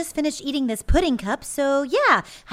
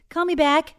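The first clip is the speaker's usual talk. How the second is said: usual speaking voice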